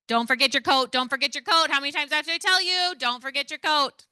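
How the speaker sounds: loud, high, exasperated nagging; fed-up parent yelling about the coat. Shown as a demonstration. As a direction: shout it at a kid who never listens. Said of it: "I am going to use strong tone and I'm going to yell".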